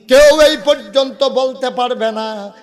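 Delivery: high, very loud preacher's cry; ringing sermon shout; emphatic declaration. Real, not acted. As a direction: declare it at full force to the crowd. Preacher swears by Allah, emphatic.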